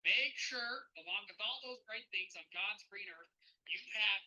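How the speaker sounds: hammed-up Canadian accent with punched openings; put-on Canadian accent, driving the point home. Shown as a demonstration. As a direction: lay on a folksy Canadian accent, emphatically. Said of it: "I'm gonna put my Canadian back hat back on".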